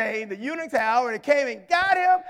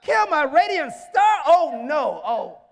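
drawn-out, indignant demand; raised voice, stretching the last question; outraged king; 3 semitones higher, 5 dB louder, slower than usual. Acted. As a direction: act a king aghast for his beloved. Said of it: "He was so mad".

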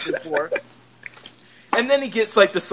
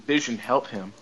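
low, softer grave order; serious; 9 semitones lower, 6 dB quieter than usual. Retold or imitated. Wanda turns to Vision as guest chokes, suddenly serious. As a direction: drop into a sober, quiet command. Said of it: "Wanda got all serious".

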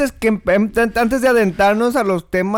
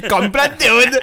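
laughing; 5 semitones higher, slower than usual. Real, not acted. Laughing at own joke.

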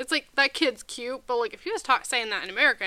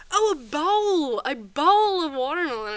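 drawn-out, higher "oh", then louder; retold dawning realization; 5 semitones higher, 6 dB louder, slower than usual. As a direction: imitate someone suddenly catching on. Retold friend finally understanding the word.

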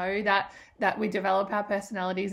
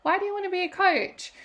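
surprised; 10 semitones higher, 3 dB louder than usual. Retold or imitated. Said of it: "And I'm like".

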